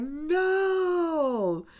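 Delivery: high drawn-out disbelieving no; shocked no. Retold or imitated. Bride hearing groom is a stripper, shocked high no. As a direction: gasp it out in disbelief. She reacts to stripper news.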